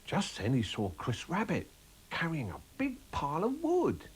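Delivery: ordinary talk, nothing marked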